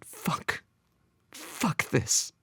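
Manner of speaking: hushed, whispered curse; whispering. A narrator's reading. Son giving up on his father, whispered to himself. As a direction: whisper it bitterly to yourself. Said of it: "Albert whispered to himself".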